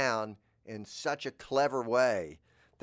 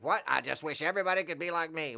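fast, higher put-on voice; mock naive voice; 6 semitones higher, faster than usual. Person wishing all were like them, mock chirpy. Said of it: "you think".

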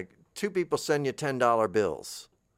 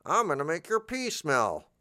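silly veggie voice; 7 semitones higher than usual. Pretend. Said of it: "like that".

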